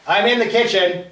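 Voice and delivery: calling out. Acted. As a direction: call back to the next room. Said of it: "I call out".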